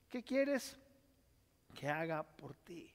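soft, fading question; tender question. Acted. Jesus asking the listener, softly and tenderly. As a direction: ask gently, almost whispered.

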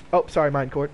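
ordinary delivery

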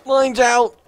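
excited shout. Real, not acted. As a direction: yell it out. Calls out in game.

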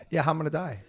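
ordinary talk

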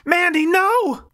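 high, loud, drawn-out scream. Acted. Narrator screaming at Mandy not to shoot, drawn-out high yell. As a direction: scream her name to stop her. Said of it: "I screamed".

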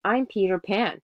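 yelled out gleefully. A narrator's reading. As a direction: announce yourself triumphantly. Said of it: "Peter yelled out, laughing".

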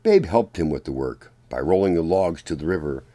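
ordinary delivery

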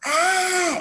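high, drawn-out "ah"; storybook cry of frustration; mock frustrated yell. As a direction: yell high without much force. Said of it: "Izzy yelled".

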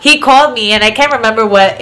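normal talking voice

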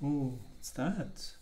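plain reading voice

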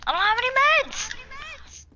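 panicked. Real, not acted. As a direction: wail it in panic. Dying outside the circle.

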